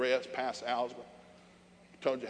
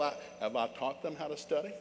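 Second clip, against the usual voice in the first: ordinary talk, nothing marked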